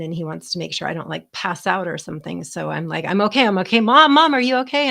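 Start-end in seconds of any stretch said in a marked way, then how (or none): 3.0-4.9 panicked. Retold or imitated. Panicked reply on the phone.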